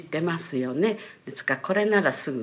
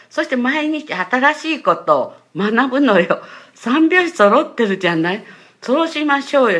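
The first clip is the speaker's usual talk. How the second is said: loud, high, insistent coaxing; retold pitch to a mother, building in volume and eagerness; 熱心な誘い; 7 semitones higher, 9 dB louder than usual. Retold eager persuading of her mother. (母を熱心に誘う声の再現)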